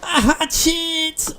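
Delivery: high, drawn-out cry of delight; excited find. Real, not acted. Player finds a hatchet in the fishing hut, delighted high exclaim. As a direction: stretch it out, thrilled at the find.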